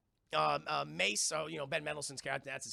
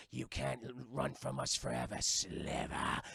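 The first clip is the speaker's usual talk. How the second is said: low, slow growling villain voice; slimy villain; 5 semitones lower than usual. Retold or imitated. Streamer impersonating Ben Mendelsohn greeting the heroine, low villain growl. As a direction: purr it like a slimy lizard villain. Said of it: "slimy salamander-esque villain with a growl".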